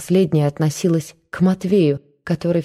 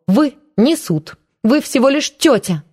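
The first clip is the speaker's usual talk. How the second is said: angry; 7 semitones higher than usual. A narrator's reading. Nyuta scolding aunt Marfa, angry and high. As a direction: put the aunt in her place, outraged. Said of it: "В голубых глазах светился гнев".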